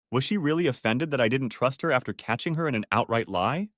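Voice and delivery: ordinary delivery, nothing marked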